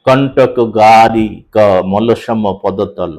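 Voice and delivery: very slow, stretching the syllables; slow metrical chant; drawn-out recitation. Shown as a demonstration. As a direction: chant the old verse, long vowels held. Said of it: "এই যে বলছি".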